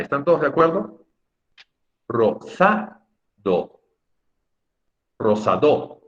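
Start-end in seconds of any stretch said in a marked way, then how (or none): 2.1-3.8 "Rosado" spaced as ro... SA... do; very slow "Rosado", lifting the middle syllable; middle-syllable stress. Shown as a demonstration. Teacher checking that all agree it is grave, "Rosado" stressed on SA. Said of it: "Rosado también es grave". 5.2-6.0 "Rosado" said as rosaDÓ; final-syllable stress. Shown as a demonstration. Teacher contrasting with the grave form, "Rosado" stressed on DO. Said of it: "también es grave".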